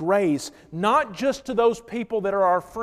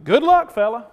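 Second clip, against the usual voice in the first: louder, scornful brush-off; spiteful dismissal; 4 semitones higher, 7 dB louder than usual. Retold or imitated. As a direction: toss it off with contempt. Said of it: "I've heard Christians say".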